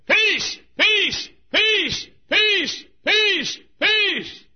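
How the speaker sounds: high, full-voiced repeated shout; hollering messenger. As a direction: holler the news across a battlefield. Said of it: "messenger, kept saying".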